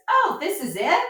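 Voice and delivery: mock let-down question, pitched high; high-pitched imitation of an underwhelmed guest; unimpressed visitor. Farm tour guest voicing disappointment to the host, mock-unimpressed.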